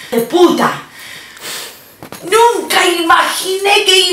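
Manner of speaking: high, slow raging mother in Spanish; furious mom. Retold or imitated. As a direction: scold the daughter with fury. Furious mom scolding daughter.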